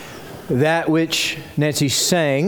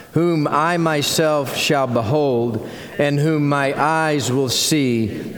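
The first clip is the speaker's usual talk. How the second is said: many voices in unison; 4 semitones lower than usual. Real, not acted. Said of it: "stand with me and we'll read it aloud".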